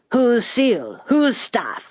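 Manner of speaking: put-on high old man's demand, each question falling; demanding graybeard. A narrator's reading. Graybeard pressing the old woman for an owner, demanding. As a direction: demand it high, in two separate thrusts. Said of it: "demanded one of the graybeards".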